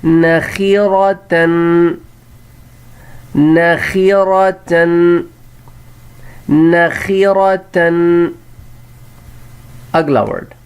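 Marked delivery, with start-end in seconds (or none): 0.0-8.3 نخیرتن drawn out, na-khi-ra-tan; slow model reading. Shown as a demonstration. Teacher to pupils, drawn-out model word. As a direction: sound out نخیرتن slowly. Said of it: "پھر تا ہے".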